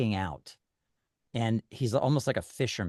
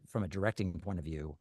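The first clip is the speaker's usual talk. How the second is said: ordinary talk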